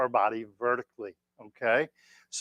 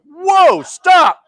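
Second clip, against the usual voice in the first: much higher, much louder shocked yelp; startled whoa; 13 dB louder than usual. Retold or imitated. Instructor stopping the old man's joke, loud startled yelp. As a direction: cut him off in alarm. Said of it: "And I was like".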